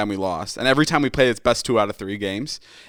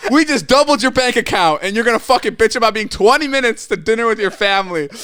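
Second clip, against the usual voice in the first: much higher, louder incredulous scolding; mock outraged retort; 12 semitones higher, 5 dB louder than usual. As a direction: throw it back at him in disbelief.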